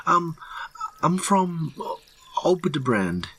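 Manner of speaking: high, halting answer with pauses; falter, then drop on the place name; nervous mutter. A narrator's reading. Terrified hiker naming his home, high nervous mutter. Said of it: "the man mutters".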